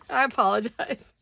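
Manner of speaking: apologizing through giggles, pitch up; laughing apology. Real, not acted. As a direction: still laughing while saying sorry. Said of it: "now I have tears in my eyes".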